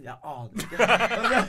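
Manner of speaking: slow, laughing through the words; laughing admission. Retold or imitated. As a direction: drag it out, cracking up. Friend admits not knowing Henning. (leende og langsomt)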